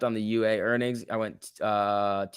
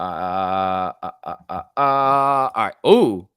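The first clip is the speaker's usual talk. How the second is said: a sudden louder burst of delight; got excited; 4 semitones lower, 5 dB louder, much slower than usual. Real, not acted. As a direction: jump up in volume as the stock spikes. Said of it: "Sorry, I got excited".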